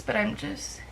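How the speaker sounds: ordinary talk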